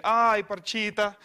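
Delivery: lightly hammed-up yell from a bystander; mock yell. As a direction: slowly call it out like an interrupting heckler. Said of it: "interrupted by guy yelling".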